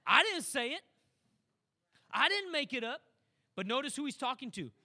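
usual speaking voice

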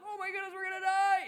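high, loud mock-panicked wail; hammed-up terror, voice shooting way up; freaking out. Disciples bailing water out of the boat, freaking out. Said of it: "they're freaking out".

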